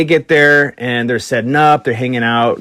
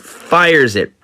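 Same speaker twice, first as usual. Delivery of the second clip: ordinary delivery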